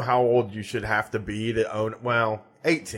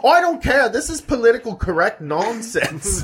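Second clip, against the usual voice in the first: loud, much higher indignant outburst; mock outraged Aussie; 8 semitones higher, 4 dB louder than usual. Imitating Aussie poster dismissing the shop, mock outrage. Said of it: "they're like".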